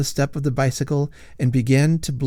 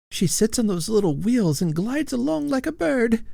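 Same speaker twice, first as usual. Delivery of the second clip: put-on high old lady ghost; 11 semitones higher than usual.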